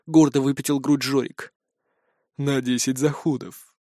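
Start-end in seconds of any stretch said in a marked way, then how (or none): 2.4-3.7 quiet, slow dismissive snort; snorting scoff. A narrator's reading. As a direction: scoff into the whiskers. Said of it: "фыркнул в усы циклоп".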